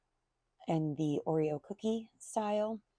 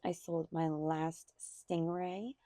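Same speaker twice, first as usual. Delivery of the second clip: ordinary talk, nothing marked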